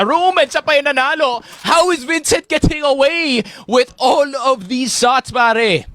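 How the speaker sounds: high, rising disbelief at the play; excited caster. Real, not acted. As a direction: sell it like nobody can believe it. Caster amazed at survival.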